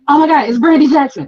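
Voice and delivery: high, loud starstruck outburst; super loud fangirl. Retold or imitated. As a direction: blurt it out loudly like an excited fan. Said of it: "like, super loud".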